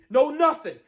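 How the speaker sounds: ordinary delivery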